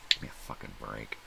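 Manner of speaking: ordinary talk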